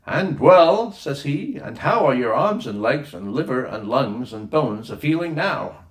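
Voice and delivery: prance in as the bustling ship's doctor; a boy imitating a jolly doctor making his rounds; jaunty doctor. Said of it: "He comes dancing onto the scene".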